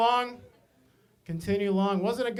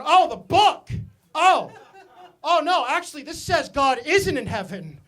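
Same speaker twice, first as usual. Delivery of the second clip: mock realization; 6 semitones higher, 5 dB louder than usual. He answers the pointing customer.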